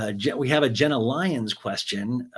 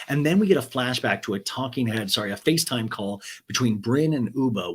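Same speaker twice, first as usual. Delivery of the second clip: normal talking voice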